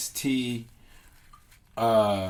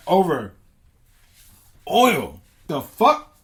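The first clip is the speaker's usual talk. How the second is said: incredulous rant; 6 semitones higher, 5 dB louder than usual. Acted. Gods ranting about war over oil.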